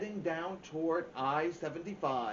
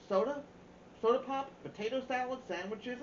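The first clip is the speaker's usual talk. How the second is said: excited list; 10 semitones higher than usual. Acted. Mama listing food with delight.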